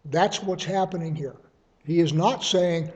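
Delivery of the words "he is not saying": ordinary delivery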